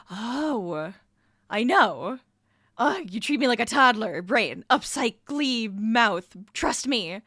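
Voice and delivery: a loud back-and-forth between brain and mouth; brain and mouth voices. Pretend. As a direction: play both sides of an inner argument, fairly loud. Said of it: "This is the conversation that would happen in your head".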